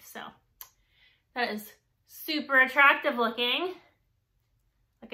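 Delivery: face covered by wet sheet; talking with mask on face; through a sheet mask. Real, not acted. Seen 0: Sheet mask covers her face, mouth opening, throughout labelled words.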